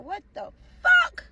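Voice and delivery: high, drawn-out startled question; retold shock. Couple jolted by police raid, acted-out shock. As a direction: stretch it out in imitated disbelief.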